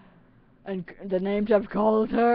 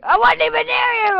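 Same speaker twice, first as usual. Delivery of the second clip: much higher, louder mock protest; imitating Kaylee; 14 semitones higher, 7 dB louder than usual. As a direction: do Kaylee's indignant squeak. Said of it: "And she'll be like".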